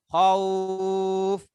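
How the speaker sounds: khauf stretched even longer. Shown as a demonstration. Teacher lengthening khauf further for the class. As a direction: hold khauf longer still. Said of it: "Panjang lagi".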